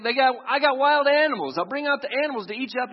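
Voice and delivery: loud, high, fast taunting threat; mock threat. Official threatens the old bishop, loud hammed-up menace. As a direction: bark the threat like a cruel official.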